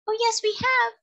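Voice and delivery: very high, tiny creature voice; small, distinct, high-pitched voice; tiny seahorse voice. A narrator's reading. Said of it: "in a tiny but distinct voice".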